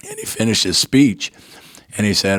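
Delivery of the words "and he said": usual speaking voice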